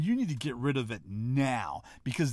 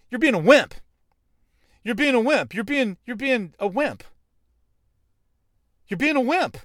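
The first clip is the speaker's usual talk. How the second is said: loud, pitched-up scolding; emphatic call-out; 9 semitones higher, 8 dB louder than usual. Real, not acted. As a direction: call them out hard, again and again. Calling out the listener.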